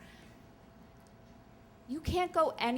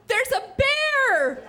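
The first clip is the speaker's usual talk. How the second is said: much higher, louder slow alarmed shriek; mock scream; 14 semitones higher, 8 dB louder, much slower than usual. Camper pointing at the mess, shrieking a warning, high and slow. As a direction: sound the alarm at full panic. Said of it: "someone screams".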